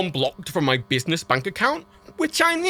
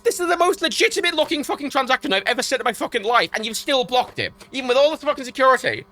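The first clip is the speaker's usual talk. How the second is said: furious rant; 7 semitones higher than usual. Real, not acted. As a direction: vent your fury at the bank, pitched high. Ranting at bank's blocked payment.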